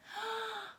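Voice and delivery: quick high gasp of anticipation; little gasp to excite the kids; excited gasp. Shown as a demonstration. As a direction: gasp like the fun is about to start. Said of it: "gasp before the move".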